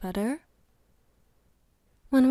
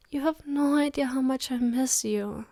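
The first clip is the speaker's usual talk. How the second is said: normal talking voice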